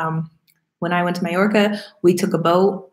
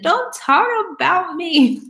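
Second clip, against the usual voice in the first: lightly mimicked protest, higher and drawn out; Mike; 9 semitones higher, slower than usual. Imagining the partner objecting to being called poor, playful mock protest. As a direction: put on a high, slow mock complaint. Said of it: "Mike's gonna be like".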